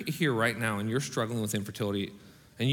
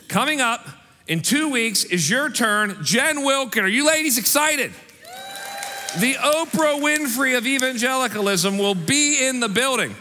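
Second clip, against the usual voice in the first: high, loud hype for the ladies; excited announcement; 11 semitones higher, 5 dB louder than usual. Real, not acted. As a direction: build the crowd up, louder and higher. Hyping women's event to congregation.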